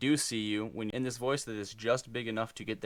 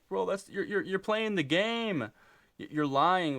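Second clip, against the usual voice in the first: much higher, indignant slowed accusation; accusing bro; 10 semitones higher, 4 dB louder, slower than usual. Retold or imitated. Skeptical guy accusing a player of lying, high and indignant. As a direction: call him out like an outraged buddy. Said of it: "some guys are gonna be like".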